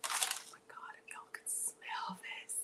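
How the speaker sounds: awed hushed whisper; barely voiced, awestruck. Real, not acted. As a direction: breathe it out in wonder. Awed whisper over the cake.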